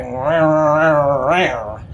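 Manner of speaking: low, slow Looney Tunes caveman grunting. Retold or imitated. Grumpy clerk imitated as a grunting cartoon caveman. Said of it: "Like that caveman on the Looney Tunes".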